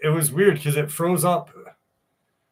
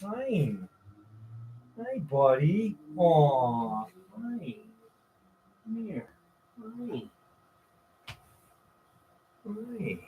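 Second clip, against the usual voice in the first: high, hushed cooing at a dog; soft high pet talk; 5 semitones higher, 7 dB quieter, much slower than usual. Real, not acted. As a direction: coo softly, voice lifted high. Cooing softly to his dog.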